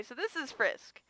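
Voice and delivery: normal talking voice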